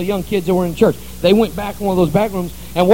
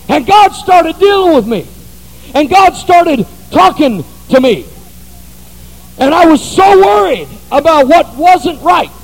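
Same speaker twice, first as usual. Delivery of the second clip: louder, rising sermon climax; full-force preaching; 8 semitones higher, 9 dB louder than usual. Acted. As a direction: preach it out, building to a shout.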